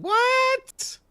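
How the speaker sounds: very high, squeaky what; mock disbelief. Host reacting to stars being shut down, mock disbelief. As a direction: leap up high in mock astonishment. Astonished at a surprise shutout result.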